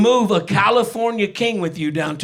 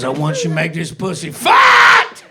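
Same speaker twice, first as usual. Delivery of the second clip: louder, pushier repeat of the request; insistent demand; 4 dB louder than usual. Retold or imitated. Girlfriend demanding it again when he dodges, louder.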